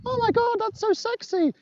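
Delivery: put-on high, breathy gushing fan voice, swooning over the accent; mock-gushing shop girl, squealing high; a server melting at a British accent, gushing high; swooning American server. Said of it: "these people will be like".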